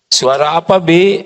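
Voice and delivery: calm, firm question; firm and polite. Shown as a demonstration. Mistress calmly asking maid what broke. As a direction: ask firmly but kindly. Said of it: "Kalau yang tegas bagaimana?".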